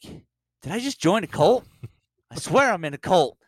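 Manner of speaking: high, loud comic disbelief; mock alarm. New CrossFit recruit realizing it's a cult, comically alarmed outburst. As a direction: blurt it out as a stunned new recruit.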